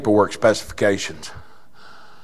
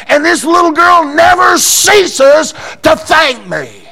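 preaching shout; 5 dB louder than usual. Retold or imitated. Preacher retelling his answer at full force. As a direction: lift it up and shout it. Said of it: "And I says".

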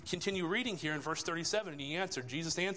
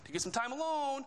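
higher-pitched, put-out mock griping; snippy complaint; 7 semitones higher than usual. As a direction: imitate disciples getting snippy with the Lord. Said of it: "getting a little bit snippy with the Lord".

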